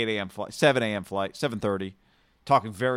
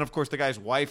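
normal talking voice

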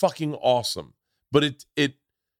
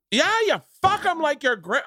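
put-on Canadian accent. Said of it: "a Canadian accent".

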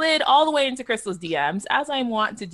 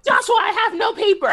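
high, loud laughing whine; exasperated; 6 dB louder than usual. Retold or imitated. Retelling her plea to Joshua, high laughing whine. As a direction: squeal it out in mock despair.

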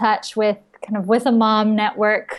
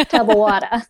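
thick New York accent, laughing; 3 dB louder, faster than usual. Shown as a demonstration. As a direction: say it like a New Yorker. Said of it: "with, like, a thick New York accent".

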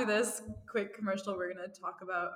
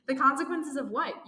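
higher, louder puzzled question; curious; 5 semitones higher, 5 dB louder than usual. A narrator's reading. "you" questioning the phone voice, curious. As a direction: ask it with open curiosity. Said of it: "you ask curiously".

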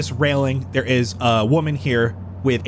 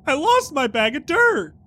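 high character voice; 11 semitones higher than usual. Acted. High-pitched bag NPC.

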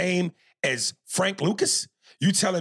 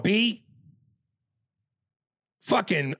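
usual speaking voice

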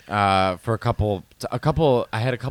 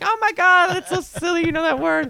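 very high squealed reaction; gushing in a tiny high voice; mimicked Korean friends' gushing. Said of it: "they always are like".

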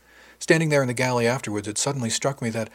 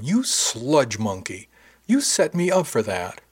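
playful accusation; 2 semitones higher, 4 dB louder, slower than usual. Acted. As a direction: accuse a friend who tricked you. Slugs him, mock accusation.

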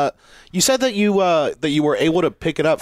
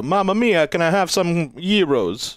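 hammed-up Italian tourist, higher and slow; mock Italian accent; 4 semitones higher, slower than usual. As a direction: play a silly tourist in a fake Italian accent. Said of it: "were you like".

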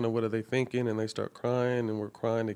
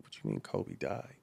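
low, hushed, stunned question; as if the news hasn't landed yet; stunned disbelief; 8 semitones lower, 9 dB quieter than usual. Retold or imitated. Recalling the moment he heard Kobe died, hushed disbelief. Retelling hearing of Kobe's death.

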